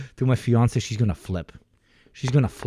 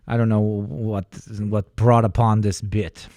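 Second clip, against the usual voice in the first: usual speaking voice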